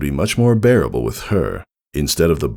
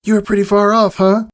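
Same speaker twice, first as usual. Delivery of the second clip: giggly tease; 5 dB louder than usual. Acted. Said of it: "Sweetie Belle giggled".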